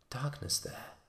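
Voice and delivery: breathy hushed whisper. A narrator's reading. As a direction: breathe it into the dark. Opens door onto darkness.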